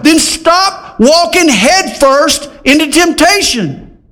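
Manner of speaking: high-pitched, driving sermon delivery; impassioned preaching. Acted. Preacher warning the congregation off temptation, impassioned and high.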